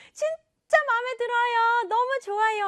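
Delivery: put-on high-pitched childlike thrill; delighted. Child thanking parents for a gift, delighted. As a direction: gush in a high, happy voice.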